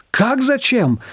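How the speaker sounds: high astonished question; gasped surprise. A narrator's reading. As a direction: gasp at such a silly question. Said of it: "ахнули дружно". (подруги изумлённо ахают, высоко)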